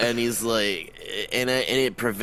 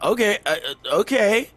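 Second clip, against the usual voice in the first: hesitant surprise; 9 semitones higher, 3 dB louder, faster than usual. Retold or imitated. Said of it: "I'm like".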